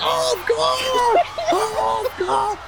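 high cheering shout of a crowd. Retold or imitated. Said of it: "And everyone's like".

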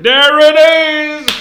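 high, very loud mock-triumphant yell; gleeful shout. Grandpa revealing the lamb to his grandson, gleeful mock shout. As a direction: blurt the reveal at full volume.

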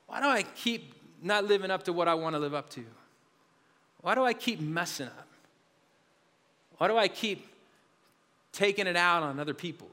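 low, hushed questions with long pauses; self-reproach. Retold or imitated. Pastor asking why he keeps failing, subdued self-reproach. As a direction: let each question sink, low and subdued.

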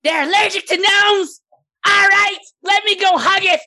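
high, loud excited gnome voice; squawky gnome working up to a battle cheer; gullible gnome. Acted. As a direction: shout eagerly as a fooled little gnome. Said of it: "he looks back over his shoulder at Shi".

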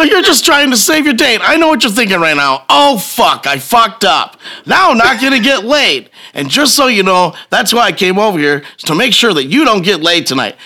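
high, worked-up acted rant; heated telling-off. Husband exposing the groper before his date, heated.

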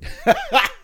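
short squealing laughter; high laugh. Real, not acted. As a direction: burst out laughing. Laugh after joke.

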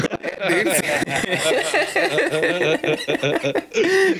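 long high cackle after the bit; real laugh, pitch way up; high cackling laugh. Real, not acted. Laughs after fake cheer.

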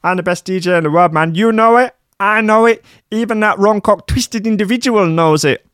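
high squeaky whiny Dutch DJ. Acted. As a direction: play a pompous squeaky superstar. [name] voice again.

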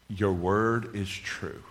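slow, drawn-out declaration; deliberate confession. Shown as a demonstration. Preacher says to the Lord his confession, slow and deliberate. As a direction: space out every word slowly.

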